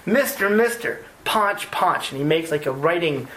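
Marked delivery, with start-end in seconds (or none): 0.1-2.1 put-on higher, pleading child's voice; eager little kid. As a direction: tug at him like an excited kid begging. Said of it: "and he goes".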